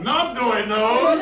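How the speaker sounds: loud, slow, drawn-out heckle; screamed out. Retold or imitated. Dorm joker shouting from the back, screamed out. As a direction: holler it from the back, drawing it out. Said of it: "some idiot in the back would scream out".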